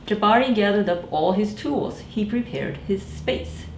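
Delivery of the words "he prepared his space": ordinary delivery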